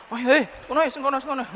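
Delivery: put-on high, eager child calling; excited child. Preacher mimicking a child pointing at food, high and eager. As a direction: point and call like a greedy kid.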